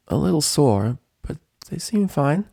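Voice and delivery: mildly surprised fine; Rainbow. Acted. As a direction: test the words carefully. Dash reply.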